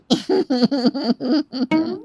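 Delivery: mother-in-law's rhythmic mock giggle; imitative laugh. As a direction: laugh it off like the daughter-in-law. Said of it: "सास भी बहू की तरह हंसकर". (बहू की तरह हँसती सास)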